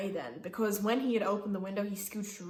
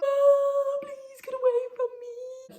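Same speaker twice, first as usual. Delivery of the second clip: very high, squealed inner plea; squeaky mock wail; 7 dB louder, slower than usual. Woman inwardly begging man leaning across her, squeaky mock wail. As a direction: start loud and squeal the begging.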